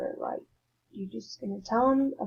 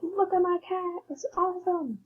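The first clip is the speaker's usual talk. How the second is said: much higher, bright excited call; 9 semitones higher than usual. Shown as a demonstration. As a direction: show off the cat, thrilled. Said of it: "Like, you're excited".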